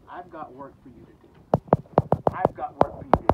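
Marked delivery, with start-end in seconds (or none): 2.3-3.3 louder, pressing the charge home; loud emphatic repeat. Acted. Jesus pressing Peter with his task, loud and emphatic. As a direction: drive the words I've got work for you to do out hard and loud.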